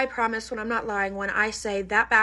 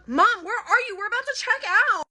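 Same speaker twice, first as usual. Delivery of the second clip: put-on much higher, whiny spoiled-girl voice; bratty kid on the phone; 12 semitones higher than usual. Girl calling her mom from the checkout line, high bratty whine. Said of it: "In that exact tone".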